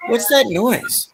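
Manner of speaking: high, slow cartoon voice; Peter. Customer asking the guy at the counter, high cartoon voice. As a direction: ask it high and stretched out.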